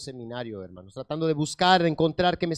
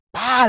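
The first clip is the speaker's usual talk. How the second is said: loud, higher-pitched "pa" of impact; imitated thud; 7 semitones higher, 11 dB louder than usual. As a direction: burst out the sound of a sudden fall. Imitates the fall to the floor.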